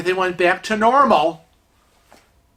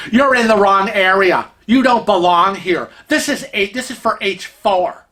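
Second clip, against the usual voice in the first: raised, indignant scolding as an imitated busybody; mock screaming; 2 semitones higher, 4 dB louder than usual. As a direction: imitate a woman loudly telling someone off. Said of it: "she starts screaming at Kevin".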